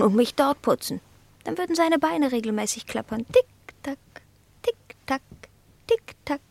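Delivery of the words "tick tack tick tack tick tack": tongue ticking. Acted. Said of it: "Im Takt meiner Zunge".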